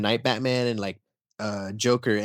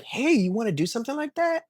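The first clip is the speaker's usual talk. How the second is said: much higher, sing-song parent voice; parent to child; 12 semitones higher than usual. Retold or imitated. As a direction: coax a small kid like a parent. Parent asks young kid.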